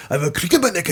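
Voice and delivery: high, loud put-on voice; hammed-up suffering passenger. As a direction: complain as if squashed, playing it for laughs. Acts the squashed passenger complaining.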